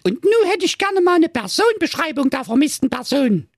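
put-on very high, squeaky girl voice; cheeky young girl. Police girl asks.

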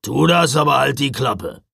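loud, gruff rebuke; growling. A narrator's reading. Driver silencing a complaining elf, growling. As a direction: growl it to shut someone up. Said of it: "knurrte Knecht Ruprecht".

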